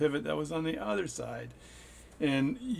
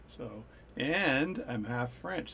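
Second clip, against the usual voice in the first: ordinary delivery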